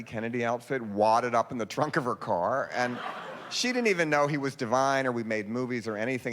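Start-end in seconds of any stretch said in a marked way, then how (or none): none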